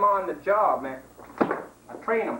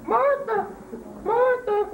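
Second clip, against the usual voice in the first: shrill high mating call; high yelling call; 13 semitones higher than usual. Acted. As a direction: yell out high and long like a lovesick animal. Mock iguana mating call.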